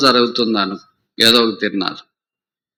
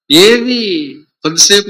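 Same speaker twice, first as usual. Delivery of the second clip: sudden high exclamation; 8 semitones higher, 4 dB louder than usual. Real, not acted. Preacher to his listeners, exclaiming high and loud. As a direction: burst out high and loud on ఏవి.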